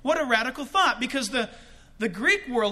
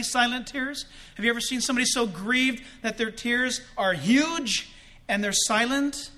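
normal talking voice

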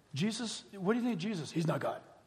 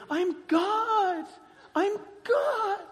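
much higher, mock ecstatic cry; really means it; 13 semitones higher, much slower than usual. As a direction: fling it out like a rapturous revelation. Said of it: "then she really goes".

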